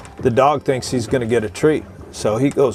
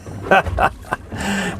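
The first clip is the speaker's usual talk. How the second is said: high, gleeful laugh; real laugh; 9 semitones higher than usual. Real, not acted. Teller laughing at the screaming inmate, gleeful laugh. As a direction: laugh at him openly. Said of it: "I'm laughing at him".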